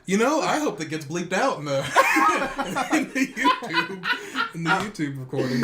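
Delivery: cracking up, voice shooting high; helpless laughter breaking into the words; laughing while talking. Real, not acted. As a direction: barely get it out through real laughter. Joking, breaks up laughing mid-sentence.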